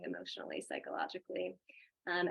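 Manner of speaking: usual speaking voice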